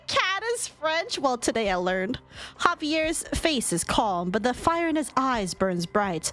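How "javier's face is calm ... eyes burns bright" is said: low, measured storytelling voice; story narration. A narrator's reading. Narrator tells of Javier seizing Sesk, story narration. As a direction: narrate the action in a lower pitch.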